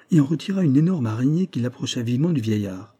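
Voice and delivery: plain reading voice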